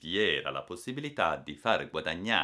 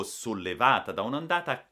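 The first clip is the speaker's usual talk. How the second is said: ordinary delivery, nothing marked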